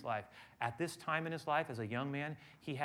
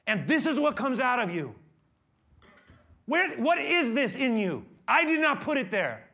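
much louder, higher shouting rebuke; a shouted rebuke of angry disciples; Jesus rebuking; 12 semitones higher, 12 dB louder than usual. Acted. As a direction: thunder at the disciples in outrage. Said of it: "he rebukes them and he says".

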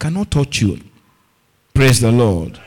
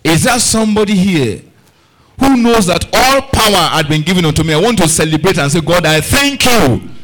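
raised, ringing call to the congregation; rousing preaching; 4 semitones higher, 3 dB louder than usual. Real, not acted. Preacher calls believers to celebrate, rousing and fervent. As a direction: rouse them, then draw out the thanks.

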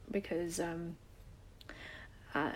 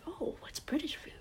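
higher, hushed, baffled question; puzzled whisper; 8 semitones higher, 5 dB quieter than usual. Retold or imitated. Asking her English aunt about cooking, a high puzzled whisper. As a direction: whisper it, half-amused and confused.

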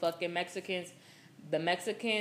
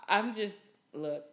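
ordinary delivery

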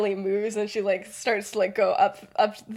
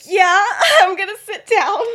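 high, loud, laughing reply; hammed-up frazzled agreement; overwhelmed outburst; 10 semitones higher, 11 dB louder than usual. [name] answering James's offer of a seat, overwhelmed outburst.

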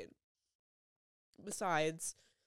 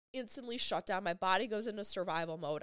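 ordinary delivery, nothing marked

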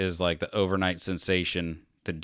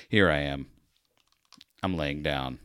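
usual speaking voice

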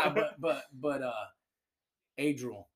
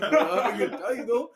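laughing repeat of the tease; 8 semitones higher, 4 dB louder than usual. Retold or imitated. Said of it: "Adriel would start cracking up".

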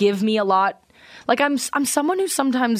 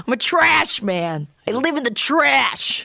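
trash man wrestler; 4 semitones higher, slower than usual. Pretend. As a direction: announce yourself like a wrestling heel.

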